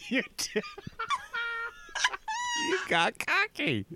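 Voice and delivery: squeaky crack mid-laugh. Real, not acted. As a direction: voice breaking high on cocky. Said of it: "Why did my voice do that?".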